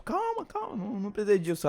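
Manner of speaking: calm him down gently; soothing girl. Retold or imitated. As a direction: falsetto "calma, calma" to settle him. Girl calming the boy.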